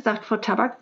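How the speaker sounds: usual speaking voice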